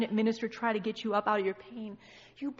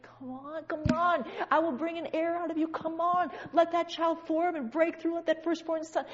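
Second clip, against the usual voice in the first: urgent exhortation; 5 semitones higher, 6 dB louder than usual. Acted. Spirit urging someone travailing in pain, urgent exhortation. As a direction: push them on like a birth coach.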